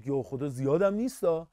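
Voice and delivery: a hint of a worried teen; imitating a student. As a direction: quietly play the shocked student. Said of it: "میگه".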